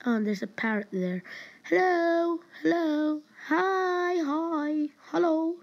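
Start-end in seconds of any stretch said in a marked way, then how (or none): 1.6-5.6 high, drawn-out sing-song hellos; calling greeting. Pretend. Player greeting a parrot in the game, high calling. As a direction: call out high to a pet bird.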